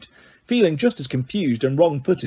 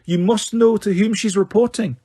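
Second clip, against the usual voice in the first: snapped; 6 semitones higher than usual. A narrator's reading. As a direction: snap back to sternness. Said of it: "abrupt return to her usual manner".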